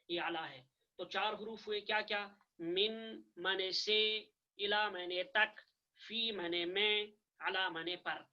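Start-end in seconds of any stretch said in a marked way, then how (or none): 2.6-8.2 slow dictation. Shown as a demonstration. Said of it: "इनके माना आप अच्छी तरह से जहन में रखिए".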